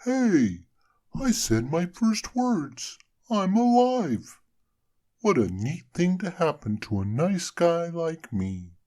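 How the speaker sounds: high, loud, slow delighted snowman; joyful snowman. A narrator's reading. Frosty delighted to find himself alive. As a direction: marvel at being alive.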